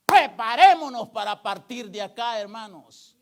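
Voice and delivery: loud, forceful preacher's call; rousing pulpit cry; urgent exhortation. Real, not acted. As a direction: call the congregation to action at full force.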